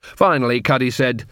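usual speaking voice

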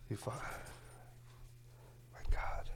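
slow exasperated whisper; hushed, fed up with the dog. Real, not acted. Hushing dog while on mic.

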